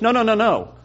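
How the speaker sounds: "No, no, no, no" fired off emphatically, high-pitched; emphatic repetition. Shown as a demonstration. Teacher showing how English repeats for emphasis, emphatic repeated no. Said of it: "it's for emphasis".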